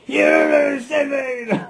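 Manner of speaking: high, loud, slow angry outburst while laughing; mock angry bark. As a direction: play the dog losing its temper, hammed up. Dog snaps back at person.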